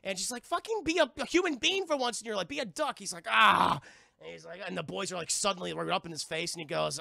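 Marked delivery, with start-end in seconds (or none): 0.4-3.0 high, loud nagging housekeeper; exasperated scolding. Retold or imitated. Maid scolds Scrooge over nephews. 3.3-3.8 loud, short "aah"; exasperated groan. Retold or imitated. Scrooge groans at being scolded.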